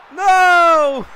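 anguished shout; loud despairing no. Real, not acted. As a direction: yell NO in dismay. Reacting to blunder.

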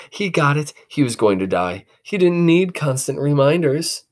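ordinary delivery

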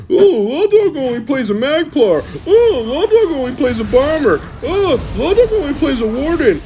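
high nasal-free mock whine of haters; mocking whiny critics. Streamer mocking the haters who complain about his class in a high mocking whine. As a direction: mimic the complainers in a high sneering voice. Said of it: "Doesn't matter what I play".

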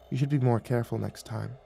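hushed, gentle admonishment; soft murmur. A narrator's reading. Nymph to dazed guard, murmuring softly. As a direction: soothe him with a soft instruction. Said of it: "murmured in a soft voice".

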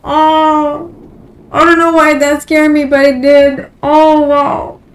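loud and rattled after the scare; startled. Real, not acted. Gamer right after a jump scare, loud and rattled. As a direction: blurt it out, still shaken.

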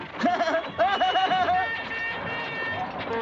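drawn-out mocking cackle; long cackle. Boasts of escapism.

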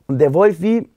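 ordinary talk

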